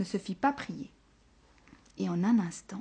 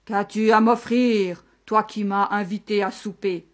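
thundering monster voice; 7 dB louder than usual. A narrator's reading. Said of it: "D'une voix de tonnerre". (voix de tonnerre, lente)

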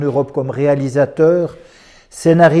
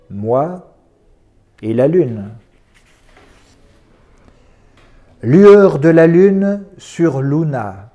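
poetry recital. Acted. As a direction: recite each poem with long, suspended pauses. Lit des poèmes à voix haute. (lecture de poésie lente et posée)

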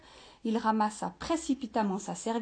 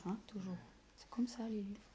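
hushed whisper; whispered aside; 16 dB quieter, faster than usual. Real, not acted. Teacher whispering an aside to the children.

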